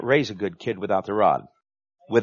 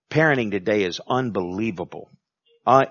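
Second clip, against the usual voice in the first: normal talking voice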